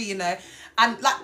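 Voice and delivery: ordinary delivery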